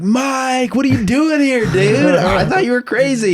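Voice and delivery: high, loud mock-surprised greeting. Friends greeting Mike at the bar in high mock surprise. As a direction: act amazed to find him at the bar.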